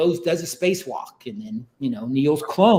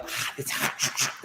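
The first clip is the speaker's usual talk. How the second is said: hushed yelp; 3 semitones lower, 11 dB quieter than usual. Retold or imitated. Clone feels heat suddenly.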